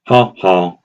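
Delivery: lightly exaggerated mocking ha ha; a hint of a cartoon bully's taunt; Nelson Muntz. Shown as a demonstration. Showing listeners how to mock a narcissist, lightly exaggerated. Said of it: "in an exaggerated manner, akin to the Simpsons character Nelson Muntz".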